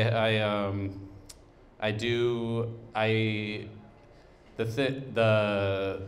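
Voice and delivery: ordinary talk, nothing marked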